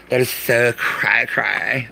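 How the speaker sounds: slow, drawn-out 'cray cray' in a put-on voice; mock slang. Streamer hamming up slang to a viewer, mocking drawl.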